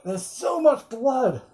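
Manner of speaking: a lightly voiced stunned Harker; mock horror. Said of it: "And he's like".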